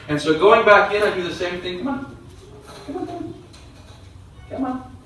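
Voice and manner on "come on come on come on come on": much higher, softer coaxing call; high soft coaxing to the dog. Real, not acted. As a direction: lure the dog in with a high sweet voice.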